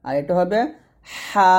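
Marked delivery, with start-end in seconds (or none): 1.2-1.5 হা with a thin, pressed breathy h; হা said thin and forceful. Shown as a demonstration. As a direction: push a sharp thin হা out. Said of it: "হা পাতলা করে".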